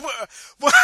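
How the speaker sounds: high loud laughing "what"; cracking up in shock; laughing disbelief. Real, not acted. Host laughing at the date mistake, loud. Realising the anniversary is wrong.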